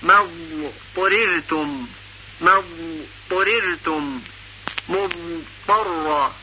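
مضطررتم drawn out very slowly and softly; slow recitation. Shown as a demonstration. Teacher models the hard ض before ط slowly. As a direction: stretch مضطررتم gently, letting the ض linger. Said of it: "مثل".

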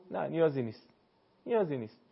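ordinary talk, nothing marked

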